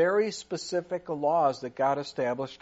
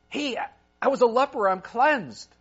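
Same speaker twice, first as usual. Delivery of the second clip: louder, quicker, bursting with joy; blurting out the good news at the door; excited news; 4 semitones higher, 5 dB louder than usual. Acted. Healed leper tells the priest at his door, excited and loud.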